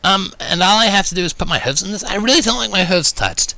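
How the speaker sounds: hesitate, a bit embarrassed; flustered. A narrator's reading. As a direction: Rainbow asking nervously. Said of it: "sounding a bit flustered".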